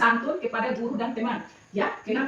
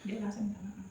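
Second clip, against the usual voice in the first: soft prompt; 6 semitones lower, 18 dB quieter than usual. Shown as a demonstration. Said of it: "Elin ucapkan bagaimana".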